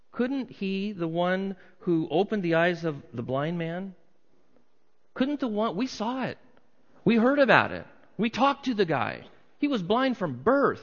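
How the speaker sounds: high, insistent crowd voice; agitated onlookers. Acted. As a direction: argue it like an astonished eyewitness. Acts out incredulous onlookers at tomb.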